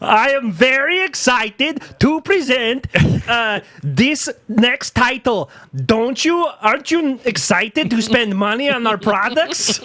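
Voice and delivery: oversell it like a cheesy presenter; mock keynote announcer. Mocking the BlizzCon stage host, high fake-excited pitch.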